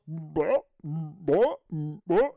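short mock heaves; imitated cat retching. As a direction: heave like a cat puking, in three bursts.

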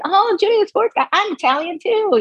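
high, bright fan gush; gushing fan greeting. Retold or imitated. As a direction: gush at him like a starstruck fan. Greeting radio guy at bar.

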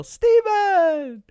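high, loud, drawn-out shout; reenacted group yell. Friends at a show when his part comes up, high yell. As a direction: yell the name out like a crowd chant. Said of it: "we would all yell".

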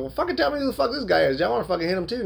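high, fired-up demand; heated demand. Retold or imitated. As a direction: push it up high, worked up. Angry demand in retold confrontation.

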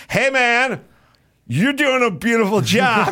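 high-pitched, gushing backpedal; mock friendly praise. Diner caught out by the waiter, gushing in mock friendliness. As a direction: turn suddenly sweet, voice shooting up.

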